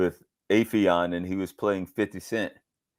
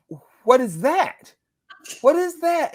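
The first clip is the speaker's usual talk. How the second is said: high, loud, incredulous question; retold shock, pitched way up; astonished; 4 dB louder than usual. Father stopping the car at his son's Hindi accent, astonished.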